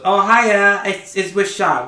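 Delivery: high, loud chirpy hello; lightly voiced cheerful NPC; cheery NPC greeting. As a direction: burst in with a bright hi.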